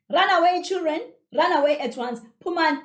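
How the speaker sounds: high, loud dismissive order; chasing away. A narrator's reading. Aunt chases the kids from the yard, sharp command. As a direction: drive them out like dogs. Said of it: "uxosha inja egcekeni".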